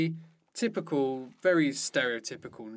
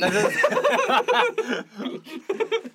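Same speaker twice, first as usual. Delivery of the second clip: high, loud burst of laughter; 8 dB louder than usual. Real, not acted. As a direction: crack up at a friend's silly voice. Cracks up at the impression.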